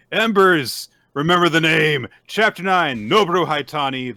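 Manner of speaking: loud, high announcer shout; big, booming chapter intro; hype title announcement. Pretend. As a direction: belt out the title like a ring announcer.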